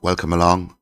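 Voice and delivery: normal talking voice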